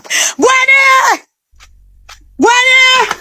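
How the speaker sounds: high, long call across distance. Real, not acted. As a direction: shout the name to reach her. Calling out for Gwenny.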